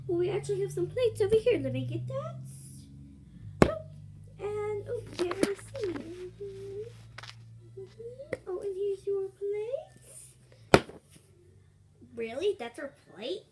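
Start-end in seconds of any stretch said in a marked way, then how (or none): 0.1-10.3 high-pitched pretend waitress; put-on high server voice; waitress character. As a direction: play the waitress in a raised voice.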